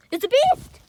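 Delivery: high, loud cry of alarm. Pretend. Kid warning the group about the cat. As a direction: warn everyone of the beast.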